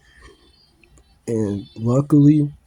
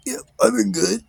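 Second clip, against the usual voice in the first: high, tight voice holding in smoke; 11 semitones higher than usual. Retold or imitated. To great aunt, talking while holding smoke in, strained high voice. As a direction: squeeze the words out with lungs full.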